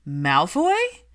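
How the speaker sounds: Malfoy? said in disbelief; surprised. A narrator's reading. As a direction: caught off guard by the name. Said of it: "Padma's surprise was visible".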